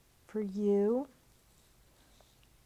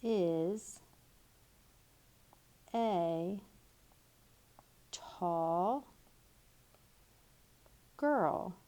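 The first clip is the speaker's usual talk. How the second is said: each word spoken apart as it is written; slow dictation; much slower than usual. Shown as a demonstration. Teacher writing on the board, slow dictation. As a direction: spell it out word by word as you write. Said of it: "So I'm gonna make a capital S".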